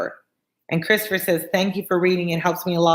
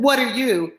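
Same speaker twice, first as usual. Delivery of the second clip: raised-pitch challenge; retorting; 7 semitones higher than usual. A narrator's reading. Guard challenging the unseen rider, retorting. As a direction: throw the question back at him. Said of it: "the guard retorted".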